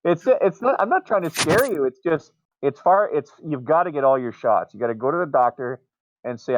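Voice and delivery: ordinary talk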